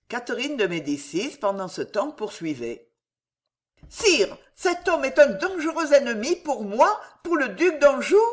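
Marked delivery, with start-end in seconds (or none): 3.9-8.2 high-pitched queen, loudly complaining; indignant queen. A narrator's reading. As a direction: lodge the complaint in a high, emphatic voice. Queen's voice in story. (reine indignée, voix aiguë)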